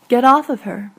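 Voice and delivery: a sudden loud, forceful order; loud command. Acted. Ex-boyfriend ordering Brutus away from her, loud shout. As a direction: bark it out to pull him off.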